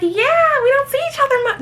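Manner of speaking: high, sing-song fake friendliness; mock fake-friendly chat. To Megan over drinks, high sing-song friendliness. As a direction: put on a bubbly party voice.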